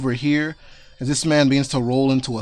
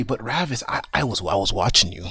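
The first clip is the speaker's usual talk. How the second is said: low, sly voice dropping lower; creepy Santa; 5 semitones lower than usual. Acted. Santa leering at Ravis, low and creepy. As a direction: purr it like a creepy old man. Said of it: "and you're gonna say".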